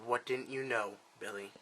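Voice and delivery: low, soft threat before the storm; father calmly menacing his son; quiet menace. Acted.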